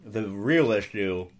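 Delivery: talking round a Mento; speaking while sucking a mint; mouth full. Real, not acted. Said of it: "sorry, I'm eating a Mento".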